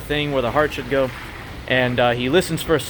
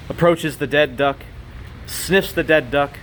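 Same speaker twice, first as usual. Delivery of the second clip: ordinary talk, nothing marked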